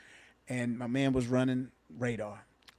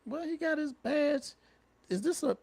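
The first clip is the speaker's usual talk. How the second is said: high, wondering mock question; a half-puzzled raised-pitch what-is-this; puzzled officer; 12 semitones higher than usual. Officers puzzling over a badge held up, high and puzzled. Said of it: "And we like".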